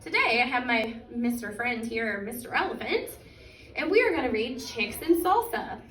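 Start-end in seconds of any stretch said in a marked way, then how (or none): none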